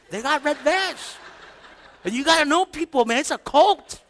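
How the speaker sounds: high-pitched incredulous warning; mock suspicion. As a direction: play a skeptic exposing a cult, voice climbing. Acts suspicious unbeliever warning.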